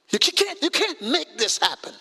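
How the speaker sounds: ordinary talk, nothing marked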